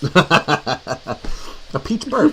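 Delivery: slow words through laughter; laughing. Real, not acted. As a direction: giggle the words out. Laughing at a burp.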